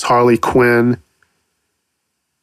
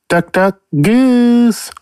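high cartoon voice; 10 semitones higher, slower than usual. Playing voices, cartoon game chant.

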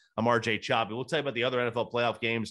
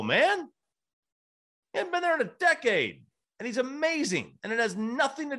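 fired-up, higher, arguing hard; heated rant; 10 semitones higher than usual. Real, not acted. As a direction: rant it with rising heat. Heated rant about Green Bay.

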